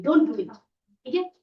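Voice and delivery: normal talking voice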